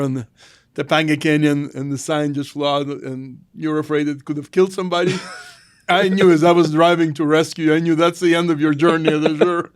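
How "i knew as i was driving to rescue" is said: ordinary talk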